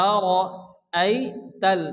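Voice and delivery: আরাইতাল drawn out very slowly, syllable by syllable; slow tajweed demo. Shown as a demonstration. Teacher spelling out আরাইতাল slowly for learners. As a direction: stretch আরাইতাল slowly for the students. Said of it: "হামজা জবর আ, র জবর র".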